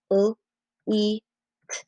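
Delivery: "L, E, T" as separate sounds, pauses between; tapped-out sounds. Shown as a demonstration. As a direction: sound out leet phoneme by phoneme. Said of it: "tap out".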